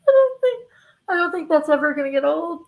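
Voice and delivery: talking through helpless laughter; can barely get words out laughing. Real, not acted. Laughing hard at her clip.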